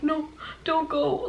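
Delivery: high, soft, slow mock plea; hammed-up soap opera begging, drawn out; telenovela-style pleading. Brother watching sibling leave, melodramatic mock plea. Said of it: "it was like a freaking telenovela".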